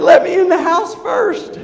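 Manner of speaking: high, slow and loud through a laugh; laughing reply. Retold or imitated. Answering the boy's daddy question, laughing loud and high. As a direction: laugh it out in disbelief.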